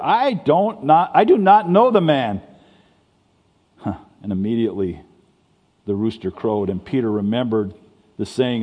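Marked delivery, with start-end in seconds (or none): 0.0-2.4 swearing denial. A narrator's reading. Peter swears to the crowd he never knew Jesus, loud and high. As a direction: swear it out loud, near a shout. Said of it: "invoke a curse on himself and to swear".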